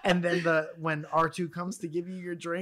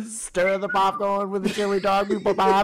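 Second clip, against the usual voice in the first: laughing robot quote; 5 semitones higher, 7 dB louder than usual. Pretend. Quoting robot order laughing.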